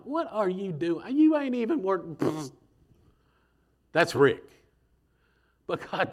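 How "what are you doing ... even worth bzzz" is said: high mocking scoff ending in a zap sound; a hint of an annoyed cartoon god; mock zapping God. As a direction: brush him off and zap him. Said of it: "I'd been like".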